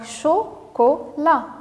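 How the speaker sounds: "chocolat" slowly split into cho, co, la; syllable by syllable. Shown as a demonstration. Teacher assembling the word for children, "chocolat" said syllable by syllable. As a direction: pause after each syllable of "chocolat". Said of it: "se compose de trois syllabes".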